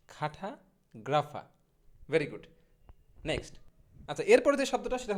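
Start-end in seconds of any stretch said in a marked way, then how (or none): 0.1-1.4 খাঠাগ্রাফা very slowly, split "kha-tha ... gra-fa"; broken-down pronunciation. Shown as a demonstration. Teacher modelling cartographer slowly, খাঠাগ্রাফা as "kha-tha, gra-fa". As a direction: draw out খাঠাগ্রাফা in two pieces, no r at the end. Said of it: "সঠিক ইংরেজিটা হবে হচ্ছে".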